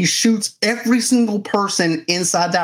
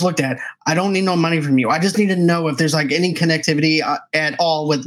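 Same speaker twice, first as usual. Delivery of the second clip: rattling it off fast; words tumbling out in a rush; a hint of adrenaline-fueled rambling. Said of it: "He's talking ninety to nothing".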